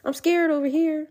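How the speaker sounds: high, hammed-up scared little voice; mock scared. Mocking men who hide their fear, in a high mock-scared voice. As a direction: pretend to cower in fear, lightly.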